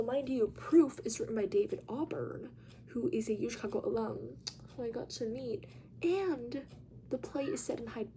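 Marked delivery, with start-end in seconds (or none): none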